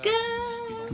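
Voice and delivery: high, drawn-out mock bawl; imitated crying wail. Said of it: "yelling, crying, like".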